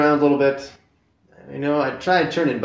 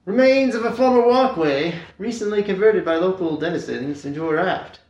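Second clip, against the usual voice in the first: high, projected tour-guide patter; playful sightseeing announcer, pitched up; 5 semitones higher, 3 dB louder than usual. Pretend. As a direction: present the sights like a guide leading a group. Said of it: "now you're like a tour guide".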